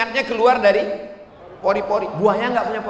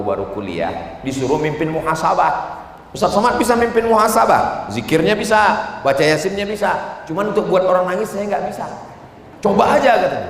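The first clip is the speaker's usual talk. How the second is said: usual speaking voice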